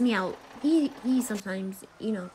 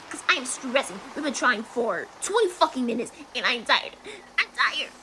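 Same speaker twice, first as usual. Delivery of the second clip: ordinary talk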